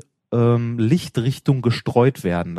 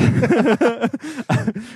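high giggle; 11 semitones higher than usual. Real, not acted. Laughs at tiny size.

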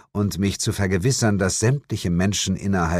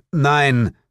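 curt, louder impatient no; 7 semitones higher, 5 dB louder than usual. Acted. The narrator answers the laughing woman impatiently. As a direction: cut her off impatiently. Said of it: "erwiderte ich ungeduldig".

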